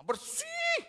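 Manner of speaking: high loud bersih; high emphatic protest; emphatic insistence. Retold or imitated. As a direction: squeal it out insisting. Insisting house is clean.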